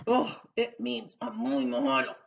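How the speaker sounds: ordinary talk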